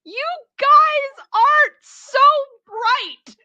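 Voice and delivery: very high, loud, stretched-out yell; mock yelling. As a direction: holler each word slowly like a jeering chorus. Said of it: "they just yell".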